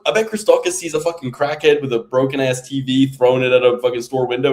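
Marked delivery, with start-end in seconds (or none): none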